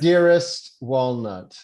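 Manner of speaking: slow, loud letter reading; formal letter reading. Acted. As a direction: read it out grandly. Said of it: "reading it out loud to Walnut".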